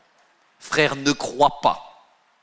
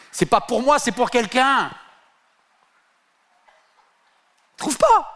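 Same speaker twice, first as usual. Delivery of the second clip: high flustered denial; 8 semitones higher than usual. Acted. Friend insists it's for someone.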